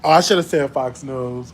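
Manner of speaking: normal talking voice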